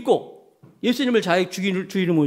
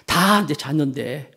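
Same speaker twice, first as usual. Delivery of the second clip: ordinary talk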